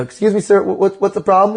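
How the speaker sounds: high-pitched courteous query; put-on polite, high voice; polite confrontation. Acting out polite question at window.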